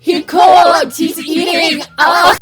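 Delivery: loud, high, drawn-out hype shouting; escalating manic yelling, words stretched out; brain-rot frenzy. Pretend. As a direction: shout it like an unhinged superfan. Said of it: "representation of my active brain rot".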